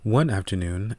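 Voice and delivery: usual speaking voice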